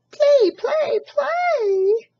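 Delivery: very high, loud gosling shout; excited baby goose. Acted. Excited baby goose begs to play, high and loud. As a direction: bounce it out with glee. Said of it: "cried the excited baby goose".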